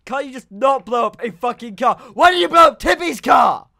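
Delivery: furious yelling; angry shout. Real, not acted. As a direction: scream it at your friend. Car blown up again.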